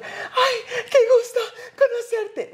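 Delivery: mock a starstruck fan near tears of joy; gushing starstruck fan. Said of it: "que estoy temblando". (fan emocionada chillando entre risas, mucho más aguda)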